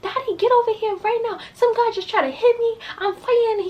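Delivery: high, urgent demand to her father; retold panicked call to dad; frantic call to dad. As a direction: plead for dad to come now. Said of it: "that's how I was like".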